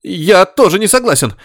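high shriek that breaks; squealing, cracking voice. A narrator's reading. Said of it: "взвизгнул каким-то срывающимся голосом".

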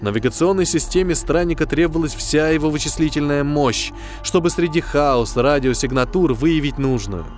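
ordinary delivery, nothing marked

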